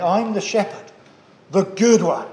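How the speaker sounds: "good" leaned on in "the good one"; stress on good. Retold or imitated. Preacher voicing Jesus' words to the congregation, stress on "good". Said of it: "good is very emphatic".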